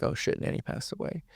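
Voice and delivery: breathless whisper of dread; a stunned whispered realization; whispered thought. Retold or imitated. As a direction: whisper it to yourself in shock. Inner thought on hearing dad's voice.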